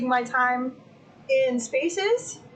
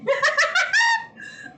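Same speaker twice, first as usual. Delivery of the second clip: high shrieking laugh. Real, not acted. Streamer laughing at herself fighting the fly, shrieking. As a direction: cackle at the whole fly scene.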